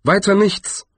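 high-pitched dwarf's exclamation; brief high exclamation; calling out. A narrator's reading. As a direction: toss it out high, dismissively. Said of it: "rief der Zwerg".

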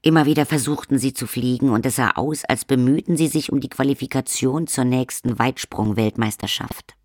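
usual speaking voice

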